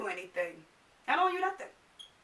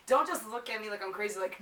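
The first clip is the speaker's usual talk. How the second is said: usual speaking voice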